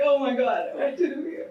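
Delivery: giggling, pitch up; amused mock panic; laughing disbelief. Retold reaction to his grass remark, laughing. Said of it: "I was like".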